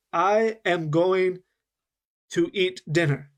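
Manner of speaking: very slow, every sound said clearly in "I am going to eat dinner"; deliberate, unlinked words with pauses; slow and careful. Shown as a demonstration. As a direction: spell it out word by word, slowly, just to be clear. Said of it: "It's very slow, and I'm saying every sound".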